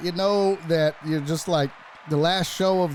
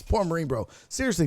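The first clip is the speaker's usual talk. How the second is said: normal talking voice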